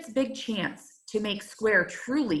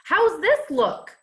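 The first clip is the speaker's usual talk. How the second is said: higher, louder showing-off ask; proud eager question; 8 semitones higher, 4 dB louder than usual. Acted. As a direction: show it off hopefully. Snippet shows off on the triangle.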